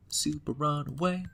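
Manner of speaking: runaway stressed, slow and rising; slow sing-song. Shown as a demonstration. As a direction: drag out runaway teasingly.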